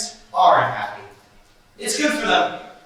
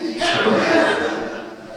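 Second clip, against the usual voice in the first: airy laugh; 6 semitones higher than usual. Acted. Laughing at his own joke.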